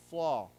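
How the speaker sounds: Flaw said loud and clear, drawn out; loud model word. Shown as a demonstration. Teacher modelling Flaw for students to repeat, loud and clear. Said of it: "say this word again".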